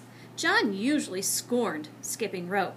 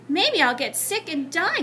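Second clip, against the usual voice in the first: warning; 6 semitones higher, 5 dB louder than usual. Acted. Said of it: "John warned".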